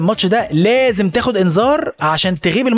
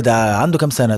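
lightly voiced coach; 8 semitones lower than usual. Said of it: "يقول لك".

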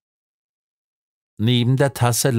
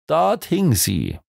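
slow, raised-pitch exclamation; called out; 6 semitones higher, slower than usual. A narrator's reading. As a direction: call it out slowly and higher, without shouting. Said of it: "rief Williams".

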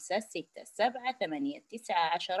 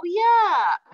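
ياء بصوت عال; 11 semitones higher, 8 dB louder, much slower than usual. Shown as a demonstration. آخر حرف في التلاوة.

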